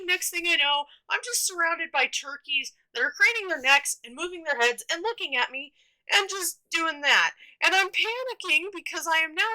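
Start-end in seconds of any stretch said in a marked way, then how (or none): none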